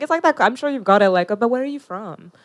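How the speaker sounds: ordinary delivery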